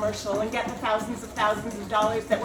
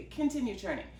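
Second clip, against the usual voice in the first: usual speaking voice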